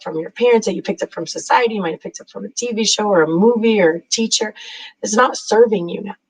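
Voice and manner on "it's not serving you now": normal talking voice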